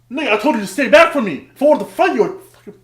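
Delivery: loud, high re-enacted outburst; heated threat. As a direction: build to an exasperated shout. Acted threat to the man.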